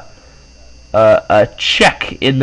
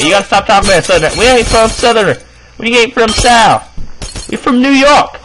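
mock southern accent; 7 semitones higher than usual. Parent telling son they ain't southern, mock southern accent. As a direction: scold in a raised, put-on southern accent. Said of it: "with that southern accent".

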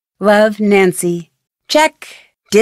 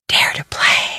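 eerie whisper; 4 dB quieter than usual. Acted. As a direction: breathe the tagline like a secret. Trailer tagline whispered for suspense.

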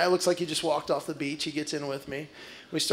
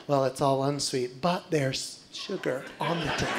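lower, softer, sugary put-down of a waiter; mock-polite waiter, softer and lower; polite condescension; 6 semitones lower, 6 dB quieter than usual. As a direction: talk down to him sweetly. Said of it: "very politely, condescendingly say".

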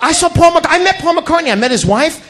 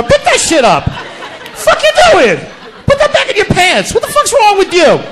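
high, laughing yell at the dog; laughing mock scolding; 9 semitones higher than usual. Yelling at dog in house.

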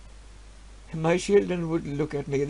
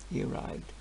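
hushed dread; 9 semitones lower, 12 dB quieter than usual. Acted. Dreaded arrival of the lord.

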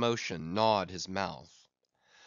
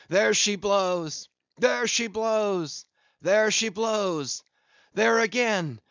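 high, loud, lingering, methodic calls; long-drawn cry; 9 semitones higher, 7 dB louder, slower than usual. A narrator's reading. Captain calling each spout of the whale, long-drawn cries. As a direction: draw each call out in the same measured tone. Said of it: "he cried out in long-drawn, lingering, methodic tones".

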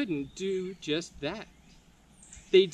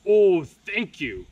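slow, much louder troll thanks; grateful troll cry; 9 dB louder, slower than usual. Acted. Grateful troll thanking Leo, loud and slow. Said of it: "cried the grateful troll".

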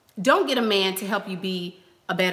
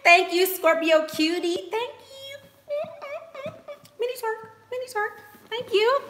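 squealy thanks. Real, not acted. Thanking viewer for stream gift.

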